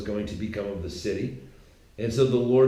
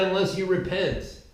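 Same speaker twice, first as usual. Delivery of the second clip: high, raised voice to reach the back; speaking up; 9 semitones higher than usual. Acted. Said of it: "Speak up. Can't hear you".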